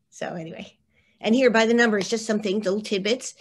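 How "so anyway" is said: whispered aside. Real, not acted. Hushed aside after laughing.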